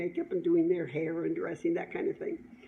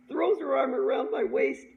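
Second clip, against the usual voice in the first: usual speaking voice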